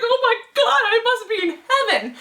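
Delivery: high, breathless rapture over the cats; giddy delight. Retold or imitated. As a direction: gush like you're in heaven. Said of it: "I was like".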